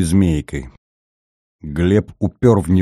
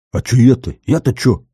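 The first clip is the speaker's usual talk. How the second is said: raised, offended protest; indignant; 4 semitones higher than usual. A narrator's reading. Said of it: "возмущался часовой".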